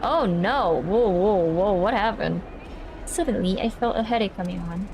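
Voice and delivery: ordinary talk, nothing marked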